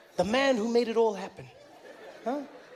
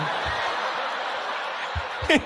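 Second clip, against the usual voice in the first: breathless silent laugh, air only; silent wheezing laugh; 7 semitones higher than usual. Real, not acted. Comic recalls a man falling, then wheezes with laughter.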